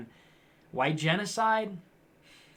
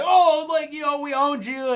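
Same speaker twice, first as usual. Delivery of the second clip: higher, louder, smug taunting leftist voice; smug mock troll; 9 semitones higher, 5 dB louder than usual. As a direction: gloat like a smug troll who thinks he won. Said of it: "I'm sure they're like".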